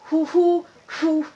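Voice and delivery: high loud whistled hoo sounds; imitated whistle. Mimicking the whistle that alarms the camp, high and loud. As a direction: whistle like a signal in the dark. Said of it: "he whistles".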